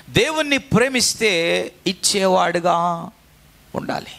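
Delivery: usual speaking voice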